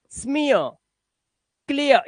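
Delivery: स्मियर, क्लियर ending in ia, no r; silent r. Shown as a demonstration. Teacher showing written r unread, ia endings. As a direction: let both words end on an open uh. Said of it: "ये जो रा लिखा है ना".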